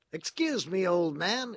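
high, loud character voice; flustered prisoner, loud and high; apologetic agitated prisoner. A narrator's reading.

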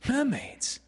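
high astonished questions, then slow relish; wonder. A narrator's reading. Peter tempting Wendy with mermaids, high wonder. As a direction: ask high in amazement, then draw it right out.

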